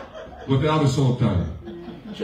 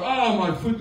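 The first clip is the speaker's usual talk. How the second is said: mock pained whine; 8 semitones higher than usual. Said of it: "and they start".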